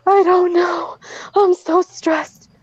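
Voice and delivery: high, drawn-out whiny wail; mock crying. Stressed friend crying when confronted, imitated in a high mock wail. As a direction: imitate someone sobbing that they can't cope. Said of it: "she started crying".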